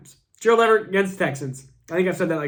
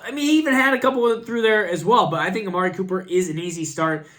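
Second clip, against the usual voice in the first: ordinary delivery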